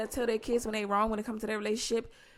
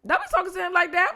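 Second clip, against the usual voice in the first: mock scolding dad; 7 semitones higher, 5 dB louder, faster than usual. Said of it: "He was like".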